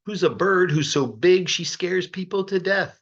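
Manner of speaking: ordinary delivery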